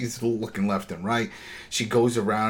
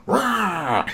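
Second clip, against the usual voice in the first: mock zombie roar; 9 semitones higher than usual. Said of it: "all of a sudden".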